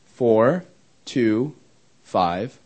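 digit by digit, with long pauses; slow dictation. Real, not acted. Speaker repeating phone number for the audience to copy, slow dictation. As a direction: dictate it slowly, leaving gaps to write.

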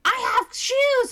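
put-on very high, loud, slow child voice; hammed-up toddler boast; little girl announcing. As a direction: proudly declare it like a small kid. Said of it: "then she's like".

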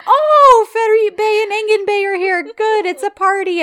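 high, loud gleeful greeting; over-the-top cheerful. Retold or imitated. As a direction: burst in delighted, like a party crasher. Voicing Erdem barging in cheerfully.

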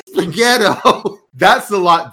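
can't get the word out for laughing. Real, not acted. Reading it again, cracking up.

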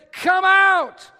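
loud high call into the tomb; commanding shout. Retold or imitated. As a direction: call him out of the grave. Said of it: "when Jesus said".

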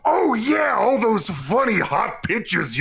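put-on high, wavering old uncle voice; Grunkle Stan. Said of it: "three points for the voice alone".

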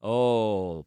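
ordinary talk

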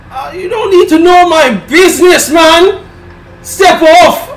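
loud, high mock-offended shout; high-pitched, hammed-up "back off" yell; indignant outburst. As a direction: tell them off at full volume.

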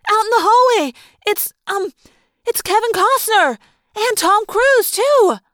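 high, loud, urgent shouting; loud feigned excitement to create a diversion; excited cry. A narrator's reading. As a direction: call it out like a frantic star sighting. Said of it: "she cried".

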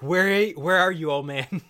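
loud, high start on a drawn-out challenge; mock confrontation. Beanie kicking in the retirement-home door, mock confrontation. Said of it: "kick the door in and be like".

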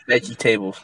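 "vegetable" said as veggie-table; mispronounced vegetable. Retold or imitated. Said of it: "Or you say vegetable".

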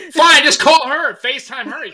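loud, high, drawn-out "fine"; exasperated parent, played up; mock exasperation. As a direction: act out giving in with annoyance. Retold exasperated exchange with daughter.

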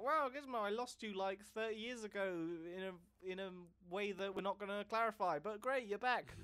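high, thrilled reunion gush; overjoyed Billy. Retold or imitated. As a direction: gush with delight over a lost pet. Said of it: "Billy is like".

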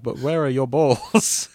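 slow, laughing mock demand; drawn-out teasing question; slow amused delivery. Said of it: "James, that's a nice kebab".

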